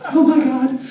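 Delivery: gasping, then high-pitched swooning squeal; freaking out. Retold or imitated. Retelling his reaction to a hot man's hello, freaking out. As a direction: gasp and gush, pitch way up, laughing. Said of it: "I'm, like, freaking out".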